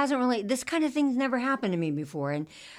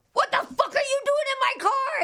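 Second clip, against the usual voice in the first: high-pitched shocked shriek; shrieking yell; 3 dB louder than usual. Retold or imitated. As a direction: scream it at the stranger. Yelling at stranger in car.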